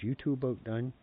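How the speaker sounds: retold exasperated question; imitated grandma interrupting. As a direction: chime in to break up the bickering. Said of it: "my grandma would chime in".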